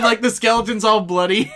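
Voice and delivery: ordinary talk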